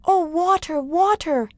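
high, excited calling; little boy Yamo. A narrator's reading. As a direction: call out to the water eagerly. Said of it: "he said to the brook".

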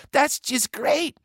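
very high-pitched cooing; high, mock-sweet delight; pleased big sister. A narrator's reading. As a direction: gush at little brother. Annie answers Joe.

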